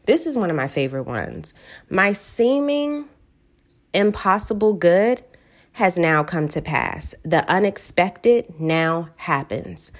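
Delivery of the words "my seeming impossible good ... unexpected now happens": slow, deliberate reading, each phrase spaced out and savoured; slow affirmation. Shown as a demonstration. Reading her favorite affirmation to listeners, slow and deliberate. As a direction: let every word of the affirmation land slowly. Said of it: "I say this one over and over again".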